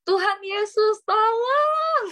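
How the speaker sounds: high, drawn-out cry for help reenacted from a dream; teriakan minta tolong. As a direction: call out loudly to Jesus for rescue, pitch climbing. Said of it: "Di mimpi itu saya teriak". (teriakan tinggi memohon pertolongan)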